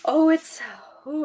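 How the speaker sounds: ordinary delivery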